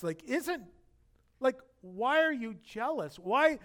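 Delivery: usual speaking voice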